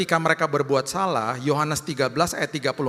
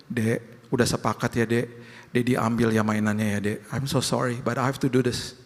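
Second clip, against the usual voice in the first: tender father; 5 semitones lower than usual. Retold or imitated. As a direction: gently tell the child the rule stands. Father gently enforcing discipline.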